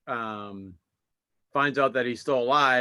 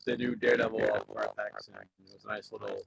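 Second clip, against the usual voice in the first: glitchy robotic microphone distortion; 5 semitones lower than usual. Real, not acted. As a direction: talk through a broken robotic mic. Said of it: "you're doing it right now".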